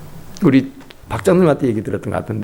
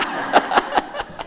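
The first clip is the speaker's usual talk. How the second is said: high cackle; 5 dB quieter than usual. Real, not acted. Preacher laughs after.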